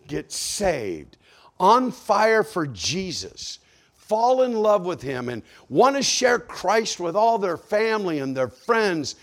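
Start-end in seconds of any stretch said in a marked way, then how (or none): 1.6-9.2 loud, high, impassioned appeal; fervent preaching. Real, not acted. Preacher urging people to be on fire for Jesus, fervent.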